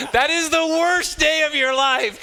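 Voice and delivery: laughing through it, pitch way up and loud. Real, not acted. Speaker laughing at his own story to the audience, laughing. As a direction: crack up as you say it.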